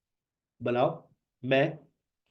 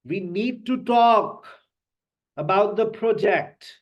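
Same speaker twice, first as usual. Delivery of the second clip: very slow, higher and louder, each word weighted; slow stressed sentence; 6 semitones higher, 5 dB louder, much slower than usual. Shown as a demonstration. Teacher models sentence stress for students. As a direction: spell it out slowly with strong stress. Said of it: "words के ऊपर भी stress करते हैं".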